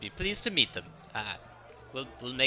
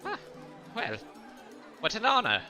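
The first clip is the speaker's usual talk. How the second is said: mock delighted; 12 semitones higher than usual. Nico answers the ogre in character.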